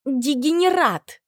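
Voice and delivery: high, slow furious insult. Acted. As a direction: spit the insult out. Оскорбление в ярости. (яростное оскорбление)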